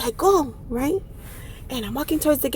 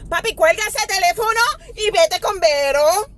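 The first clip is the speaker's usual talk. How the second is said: higher-pitched exasperated plea; frustrated pleading; 5 semitones higher than usual. Retold or imitated. Traveler begging Papi to hang up, frustrated. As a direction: plead it high, patience running out. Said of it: "Frustration in my heart".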